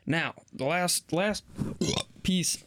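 talking mid-sip of soda; words around a fizzy drink; while sipping a drink. Real, not acted. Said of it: "I'm so sorry. I'm drinking a Doctor Pepper".

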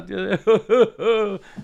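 loud, mocking burst of laughter; hearty mocking laughter; big group laugh. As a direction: laugh the kid off the stage. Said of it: "They're a big laugh".

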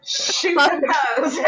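slow, laughing through the words; cracking up while she jokes. Real, not acted. Joking about her friend's gun, laughing.

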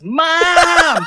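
long, high, loud yell for mom; screaming. Retold or imitated. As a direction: imitate a boy yelling for his mother. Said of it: "he just screams at the loudest he can".

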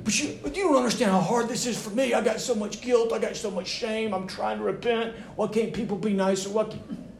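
high, whiny mock complaint; mock whimper of wounded self-pity; self-pitying whine. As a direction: play the sulking husband pitched well up. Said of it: "just wallowing in this self-pity".

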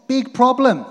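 high, loud punch on big problem; exclaimed. Acted. Preacher warning the church loudly. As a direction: hit it hard like an alarm.

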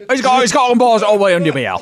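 high, loud fake British; hammed-up British heckling; shitty British accent. Host butting in on the presenter, loud mock British. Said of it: "activate shitty British accents right now".

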